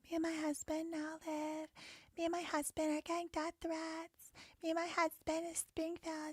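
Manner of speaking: high, soft put-on voice; mocking sweet woman. Livestreamer mocks the video woman talking about her husband, mock sweet. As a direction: mimic her in a tiny high voice.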